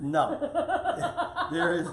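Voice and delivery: laughing through the words; can't stop chuckling. Real, not acted. Laughing at wife's bed joke.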